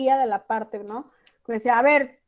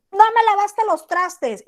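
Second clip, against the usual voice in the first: high, loud, slow scolding "No me lavaste los trastes"; angry mom text; 8 semitones higher, 5 dB louder, slower than usual. Retold or imitated. Mother scolds her child over unwashed dishes, mock angry. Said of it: "le pones la carita enoja".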